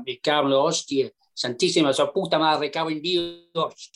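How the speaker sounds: put-on Spanish swearing in a broad Yorkshire accent. Caddie retelling Sevi's curse after a pulled shot, Spanish-Yorkshire accent. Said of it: "your Spanish stroke Yorkshire accent".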